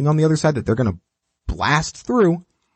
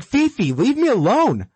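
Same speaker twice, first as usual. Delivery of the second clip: higher, hammed-up yell at the dog; mock exasperated yell; 9 semitones higher than usual. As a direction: play an owner losing patience, pitched up. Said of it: "You're like, like".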